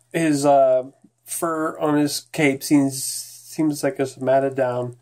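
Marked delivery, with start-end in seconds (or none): none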